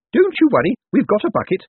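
a fussing carer covering her fear; nervous nurse. A narrator's reading. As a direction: Miss Drapes fussing over her patient, high and jittery.

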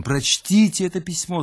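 high, eager request; eager urging. Acted. Louise urging the narrator to read, eager and high. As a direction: press the letter on him gladly.

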